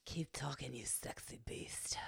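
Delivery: whispered, breathy come-on, trailing off slowly; worked-up purr. Acted. Gladys to the guest talking about dogs, breathy and worked up. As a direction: whisper it as an aroused flirt. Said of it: "you're getting her all worked up".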